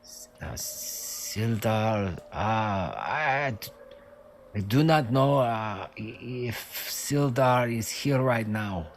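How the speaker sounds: put-on halting, accented prisoner voice; foreign-accented guard, full of ums; hesitant captive guard. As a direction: feel your way through a vague answer. Plays the captive guard answering.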